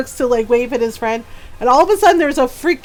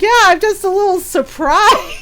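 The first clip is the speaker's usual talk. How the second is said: laughing; 9 semitones higher, 5 dB louder than usual. Retold or imitated. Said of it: "My dad's like".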